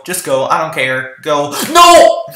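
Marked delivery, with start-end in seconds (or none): 1.7-2.2 loud frustrated yell; angry shout. Real, not acted. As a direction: scream it out in frustration. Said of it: "I heard my voice echo through the room".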